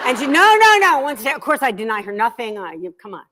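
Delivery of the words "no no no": high, loud protest; daughter's loud no. Retold or imitated. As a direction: yell the refusal like a stubborn daughter. Daughter refusing to toss rug.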